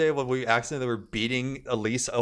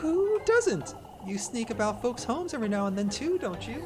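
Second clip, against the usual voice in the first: much higher, needling squeak; sly nosy neighbour; 13 semitones higher than usual. Acted. Neighbour needling Elise, high sly squeak. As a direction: turn the accusation back slyly. Voicing Miffy.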